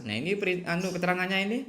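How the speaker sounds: ordinary talk, nothing marked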